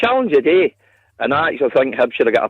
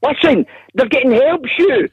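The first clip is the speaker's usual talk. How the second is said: much higher, urgent pleading; worked-up protest; 10 semitones higher than usual. Real, not acted. Fan insisting to host, rising worked up. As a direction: push the point harder.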